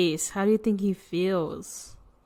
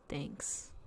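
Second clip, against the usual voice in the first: whispered, much softer and lower; whispered thanks; 7 semitones lower, 18 dB quieter than usual. Acted. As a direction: breathe it out with no voice. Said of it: "and the bear says".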